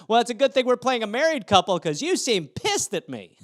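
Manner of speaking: high-pitched, laughing through the joke; acted nervous joviality; awkward joking. Awkward joke to angry girl.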